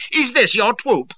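high, loud, slow furious bellow; bawling. A narrator's reading. An enraged lord bawls at a captain in the stable yard. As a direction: bellow it down from horseback. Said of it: "he bawled".

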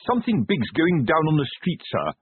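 earnest. A narrator's reading. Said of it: "said Fred earnestly".

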